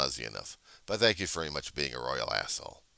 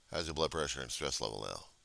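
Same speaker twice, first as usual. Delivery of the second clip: normal talking voice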